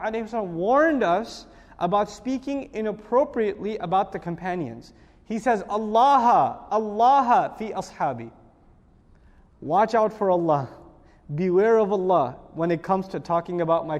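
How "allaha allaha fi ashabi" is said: "Allaha" drawn out slowly and loud, falling; warning tone. Shown as a demonstration. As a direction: stretch "Allaha" like a solemn warning. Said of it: "But how did he say it?".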